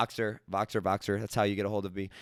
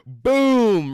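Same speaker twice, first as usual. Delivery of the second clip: a sudden loud, high burst of realization; excited boom; 10 semitones higher, 9 dB louder than usual. Retold or imitated. Telling a friend about a realization, an excited shout. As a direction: punch it out like it just hit you. Exclaiming the moment it clicked.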